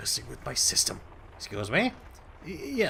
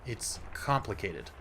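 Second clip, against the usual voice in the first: usual speaking voice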